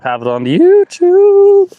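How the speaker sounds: squeaky high, slow and loud on YouTube; high falsetto call. Acted. Telling viewers he's live, in a high falsetto jingle. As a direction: pipe up like a jingle. Announcing the livestream platforms.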